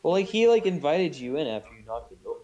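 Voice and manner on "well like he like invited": excited burst. Real, not acted.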